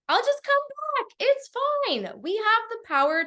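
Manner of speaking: very high-pitched, playful reassurance; airy dismissal. Acted. Speaker telling herself failure is fine, airy dismissal. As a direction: toss it off way up high. Acts out shrugging off possible failure.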